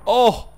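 loud, high "oh!"; startled yelp. Real, not acted. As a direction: yelp suddenly in surprise. Sudden hit in game.

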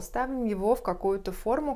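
ordinary delivery

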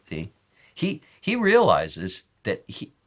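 ordinary delivery

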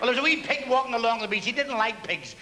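usual speaking voice

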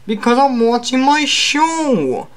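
high, slow character holler; Hulk voice. Acted. Hulk too busy with his show, high drawn-out character voice.

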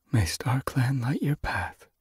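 hushed, slow, high blessing; whisper. A narrator's reading. Sage Whisker blessing Yellowfang, whispering. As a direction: give a blessing softly and slowly. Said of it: "she whispered".

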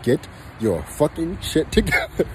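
normal talking voice

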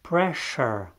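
pressure with SH in the middle, "presh-er"; English pressure. Shown as a demonstration. Teacher modelling English pressure, SH sound. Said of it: "ca un ș românesc".

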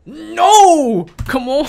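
high, loud cry of frustration; frustrated shout. Real, not acted. Player shouting at the game that misheard him, exasperated. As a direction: yell No! at the stubborn game. Speech recognition fails again.

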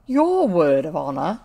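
loud, low mocking repeat of his words; scornful echo. A narrator's reading. The gentleman scoffs at Oswald's promise, loud and scornful. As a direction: throw the boy's promise back with contempt. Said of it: "in tones for which".

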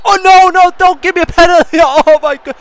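high, loud pleading with a laugh; panicked shouting. Real, not acted. Gamer begging not to concede a penalty, panicked shouting. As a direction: yell in alarm at the referee's call.

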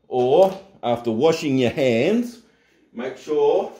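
normal talking voice

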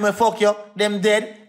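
ordinary delivery

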